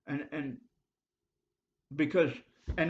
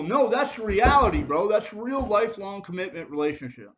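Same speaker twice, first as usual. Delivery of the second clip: loud insistence; 6 semitones higher, 8 dB louder than usual. Real, not acted. Passionately arguing his point.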